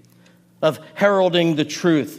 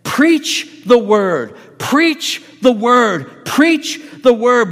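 slow, louder and higher with each preach the word; building insistent shout of preach the word; urgent repeated command; 5 semitones higher, 4 dB louder, much slower than usual. Retold or imitated. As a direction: shake him by the shirt with your voice. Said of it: "pulled Timothy up by his shirt and said".

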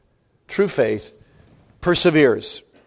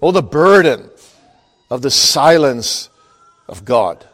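ordinary talk

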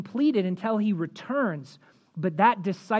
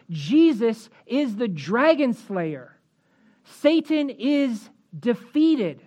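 triumphant preaching; 4 semitones higher, 4 dB louder than usual. Acted. Preacher proclaims the devil's defeat.

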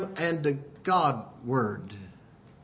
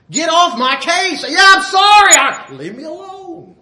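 irritated retort; 9 semitones higher, 8 dB louder than usual. Retold or imitated. Annoyed reply to being chewed out.

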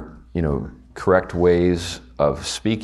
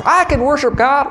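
put-on southern accent; 7 dB louder than usual. Pastor to class, mocking a churchless believer in Southern accent. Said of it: "I keep resorting to my southern accent".

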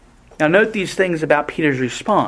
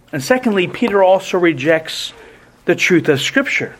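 ordinary delivery

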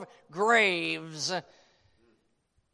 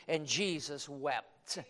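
hushed reverence; 5 semitones lower, 5 dB quieter, slower than usual. Shown as a demonstration. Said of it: "Shortest verse in the Bible".

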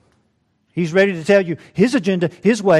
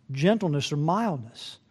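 ordinary delivery

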